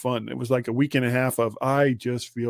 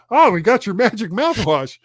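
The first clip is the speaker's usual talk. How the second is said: cheery pharmacy clerk; 8 semitones higher, 8 dB louder than usual. Retold or imitated. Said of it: "the pharmacy calls me and goes".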